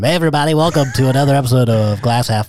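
cheesy radio host intro; hammed-up DJ welcome. Shown as a demonstration. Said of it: "talking like this the whole time".

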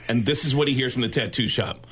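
usual speaking voice

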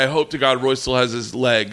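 ordinary delivery

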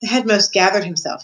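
ordinary delivery

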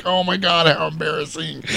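high jeering voice breaking into a laugh; gleeful mockery. Biker in the background mocks the guy who got punked, gleefully. Voicing Paul's mockery of Michael.

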